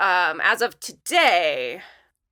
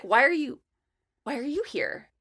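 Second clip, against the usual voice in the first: imitating a careful, higher-pitched restart; tactful puzzlement; 5 semitones higher than usual. Old coworker puzzled to see her at event, tactful. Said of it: "trying to find a nice way of saying".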